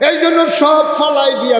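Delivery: high, loud wailing preach; father and son weeping, preached loud and high; impassioned preaching. Acted. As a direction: cry out the scene to the crowd. Preaching at full force.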